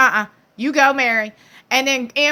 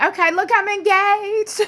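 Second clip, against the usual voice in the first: perky; 9 semitones higher than usual. Retold or imitated. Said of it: "And Christine's like".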